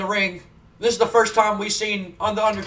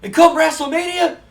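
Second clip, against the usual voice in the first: much higher, much louder shouting wrestler promo; Scott Steiner promo; 9 semitones higher, 6 dB louder than usual. Retold or imitated. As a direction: bellow the trash talk like a wild wrestler on the mic. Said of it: "Scott takes the microphone and goes".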